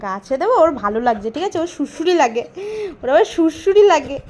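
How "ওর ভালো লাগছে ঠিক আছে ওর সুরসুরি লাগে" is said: ordinary delivery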